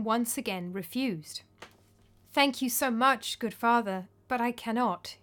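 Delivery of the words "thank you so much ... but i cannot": usual speaking voice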